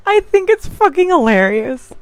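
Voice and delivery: high-pitched, tickled delivery; amused laughing. Real, not acted. Laughing at the conspiracy theory.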